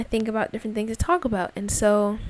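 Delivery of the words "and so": ordinary talk, nothing marked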